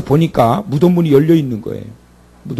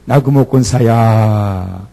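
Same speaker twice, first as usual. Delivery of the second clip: gentle divine call; 4 semitones lower, much slower than usual. Acted. God calling a congregant.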